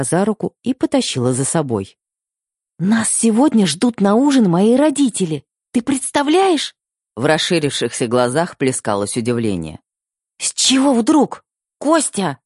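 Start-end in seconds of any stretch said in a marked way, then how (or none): 2.8-6.7 put-on high, excited young woman voice; bursting with happy news; excited girl. Anya excitedly telling her fiancé. 10.4-12.4 put-on high, slow, amazed young woman; disbelieving, then calling his name; surprised girl. Anya's surprised outburst to Kostya.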